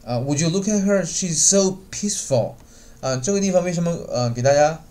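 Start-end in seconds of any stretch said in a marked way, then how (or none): 0.2-2.6 higher voice, "peaceful" stretched long and heavy; stress on peaceful. Shown as a demonstration. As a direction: lean hard on "so peaceful", drawing it out. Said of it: "强调一下这个语音".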